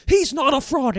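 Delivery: high, exasperated little guard voice; high scolding beet guard. Acted. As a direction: scold the two helpers in a squeaky voice. Guard scolding two helpers.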